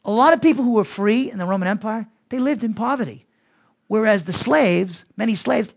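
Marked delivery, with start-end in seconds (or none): none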